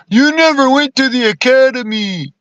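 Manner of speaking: high, snide mimicry of a recruiter; mocking a dismissive recruiter; mocking quote. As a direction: sneer it back at them like the people who turned him down.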